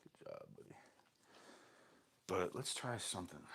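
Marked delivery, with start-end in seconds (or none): 0.0-0.8 whispered praise to the dog; hushed good job, buddy; soft praise. Real, not acted. Praising dog softly while training.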